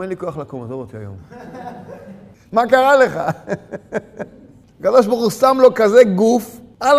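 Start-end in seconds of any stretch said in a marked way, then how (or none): 0.0-1.2 low, soft lazy mumble; soft, lazy voice of a man who won't get out of bed; lazy grumble. Retold or imitated. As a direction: mumble it like you can't be bothered to get up. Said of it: "אז הוא אומר".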